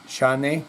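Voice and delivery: chane as shah-neh with a soft rise; Spanish-style name. Shown as a demonstration. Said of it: "they always say chane".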